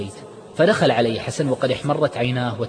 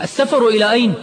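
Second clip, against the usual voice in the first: alarmed shout, much higher and louder; 12 semitones higher, 8 dB louder than usual. Acted. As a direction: jump up and yell the question. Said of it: "نهض عماد من مكانه وهو يصيح".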